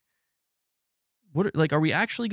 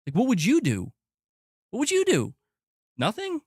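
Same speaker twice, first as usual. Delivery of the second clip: high-pitched incredulous questions, pitch climbing as he repeats; exasperated challenge; 10 semitones higher than usual. Real, not acted. Streamer challenging the people who keep poking him, exasperated and incredulous. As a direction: demand an answer, voice climbing in disbelief.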